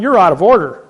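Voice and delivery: retold outburst from the witness stand; retold shout. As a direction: call out the judge loudly in court. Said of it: "I'm going".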